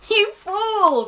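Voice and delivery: high, loud jeering shout; scornful cry. Acted. Rob jeering at Gran on the drain, loud. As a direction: yell it up at the trapped one. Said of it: "Rob cried".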